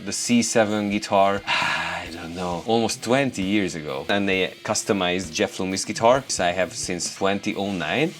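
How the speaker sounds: ordinary delivery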